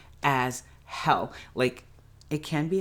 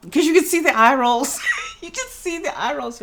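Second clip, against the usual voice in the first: incredulous, laughing; 12 semitones higher, 6 dB louder than usual. Real, not acted. Mocking the actors' eye rolls.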